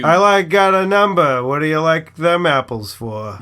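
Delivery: put-on hammy Good Will Hunting Boston accent. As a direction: drop the r's, thick Boston. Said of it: "That's my Boston accent".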